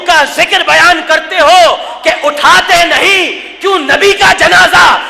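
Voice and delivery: loud, high accusatory questions; impassioned preacher shouting at climax; fiery challenge. Real, not acted. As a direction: hurl the questions out at full force.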